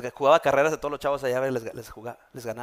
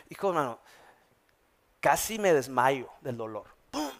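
ordinary talk

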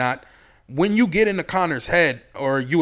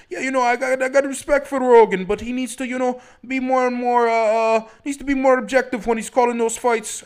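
high, loud Irish brogue imitating Conor; Irish accent impression; 9 semitones higher, 5 dB louder than usual. Podcaster mimics Conor McGregor talking about Rogan, hammed-up Irish accent. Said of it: "That was my best Irish accent right there".

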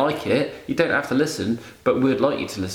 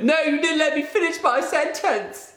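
much higher, mock whiny imitation of his co-host; mimicking her. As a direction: squeak out her protest like a sulky child. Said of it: "And she went".

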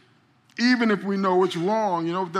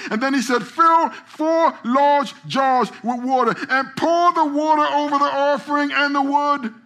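high, raised voice building to shouted commands; full-force preaching; 6 semitones higher, 4 dB louder than usual. A narrator's reading. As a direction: drive the story to a loud climax. Preaches the story's climax.

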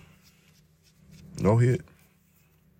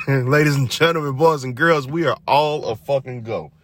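mock announcer; 8 semitones higher, 4 dB louder than usual.

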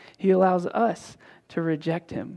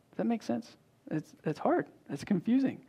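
softer, confiding check-in; quiet aside; 3 semitones higher, 7 dB quieter than usual. Real, not acted. Pastor softly checking the congregation follows, quiet. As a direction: lean in and ask them gently.

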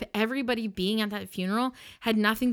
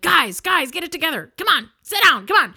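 raised, high call to break up a fight; pleading shout; 8 semitones higher, 9 dB louder than usual. Retold or imitated. Minister calling brawling brothers to order, high urgent shout. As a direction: imitate someone yelling for order.

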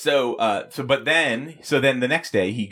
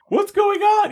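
very high, louder startled cry; shocked high squeak; 5 dB louder than usual. Retold or imitated.

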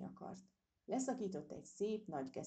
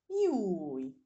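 a much louder startled yelp of pain; frightened shriek; 13 dB louder than usual. Acted. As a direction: shriek as the burr hits her nose. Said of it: "sikoltotta rémülten".

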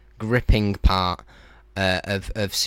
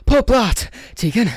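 much higher, louder hammed-up yell; mock shout; 7 dB louder than usual. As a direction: belt the punchline out. Doctor's joke answer, shouted.

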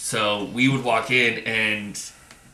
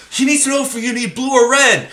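much higher, louder urgent relay; breathless report; 14 semitones higher, 8 dB louder than usual. Retold or imitated. New guy reports back to his mentor, high and urgent. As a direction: rush back and report it, pitched way up. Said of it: "he's like".

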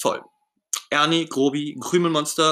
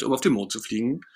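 ordinary delivery, nothing marked

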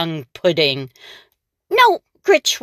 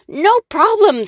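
high, louder witch brushing it off; 12 semitones higher, 4 dB louder than usual. Acted. The witch checking her pantry, high and breezy. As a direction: toss it off like a cocky witch. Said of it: "said Gritch".

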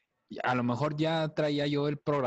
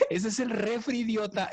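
mother scolding; 9 semitones higher than usual. Retold or imitated. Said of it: "Y me dice".

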